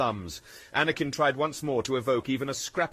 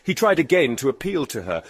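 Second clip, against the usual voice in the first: plain reading voice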